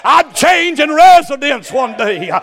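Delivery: loud, slow, ringing preacher cadence; full-force preaching. Real, not acted. Pastor preaching hard to the church, loud and ringing. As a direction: preach it out to the congregation. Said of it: "I'm preaching too hard".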